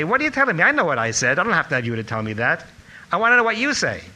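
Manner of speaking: loud, high, indignant rebuke; imitated scolding. Rabbi rebukes student who repeated his words, loud indignant scolding. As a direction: tear into the student for parroting. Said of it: "more of a kick in the shins".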